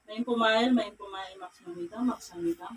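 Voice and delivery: low, hushed healer's chant; murmured incantation. Real, not acted. Healer recites incantation over patient. (pabulong na orasyon)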